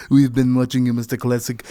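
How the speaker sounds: lightly hammed Russian spy accent; terrible Russian accent. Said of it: "with a Russian accent".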